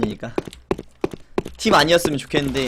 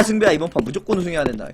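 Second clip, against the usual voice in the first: ordinary talk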